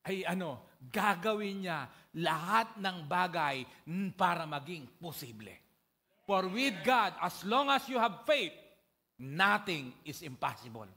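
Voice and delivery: loud, fervent preaching; preaching at full force. Acted. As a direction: drive the point home to the church. Climax of sermon.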